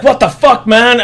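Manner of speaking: a loud what-the-hell, half acted. Chris Pratt yells as mercenaries shoot Blue, mock outrage. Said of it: "Chris Pratt's like".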